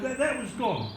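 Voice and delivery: normal talking voice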